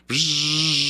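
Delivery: loud buzzing camera wind-on; vocal camera-motor buzz; imitated camera whir. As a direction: buzz like a motor winding film. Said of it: "until the camera goes".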